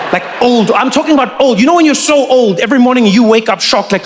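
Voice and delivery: normal talking voice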